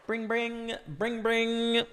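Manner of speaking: high sing-song ring-ring noise; phone ring imitation. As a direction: imitate a telephone ringing.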